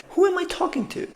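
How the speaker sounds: high-pitched puzzled question; baffled at yourself; incredulous self-check. Retold or imitated. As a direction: catch yourself mid-rant, voice jumping up. Catches himself mid-rant.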